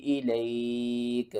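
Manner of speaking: recited phrase by phrase with long pauses; Quranic recitation. Shown as a demonstration. Teacher recites the verse for students, paused recitation.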